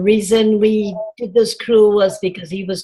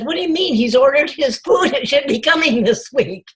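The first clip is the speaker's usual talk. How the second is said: higher-pitched, mildly incredulous quote; retold surprise; 6 semitones higher than usual. Coach on the phone disputing that he won't join, surprised. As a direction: quote her puzzled, lifted-pitch reply.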